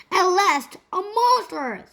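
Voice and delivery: finally, the big find; excited. A narrator's reading. Gold Avenger spots the squirrel in the rope, excited high cry.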